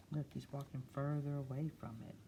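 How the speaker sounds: usual speaking voice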